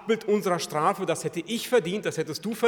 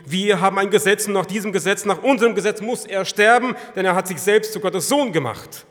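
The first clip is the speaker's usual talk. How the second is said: loud, higher insistent demand; accusers insisting; 5 semitones higher, 10 dB louder than usual. Acted. Accusers to Pilate, loud and insistent. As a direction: insist on the law, loudly.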